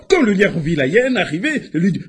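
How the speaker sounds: ordinary delivery, nothing marked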